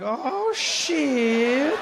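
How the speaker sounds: the snake voiced high and dismayed; a cartoon snake realising it's alone; startled creature muttering a curse; alarmed snake. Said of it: "the snake was also like".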